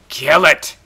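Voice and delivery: triumphant punch. Real, not acted. Gamer killing a building.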